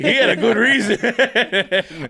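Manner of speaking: high, loud and laughing, slowed down; cracking up mid-sentence. Retold or imitated. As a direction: laugh the words out in disbelief.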